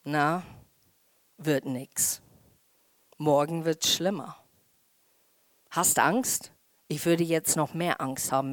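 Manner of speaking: low, understated menace from a giant; lightly voiced taunting giant. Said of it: "dann spricht deine Riese". (leicht angedeuteter, höhnischer Riese)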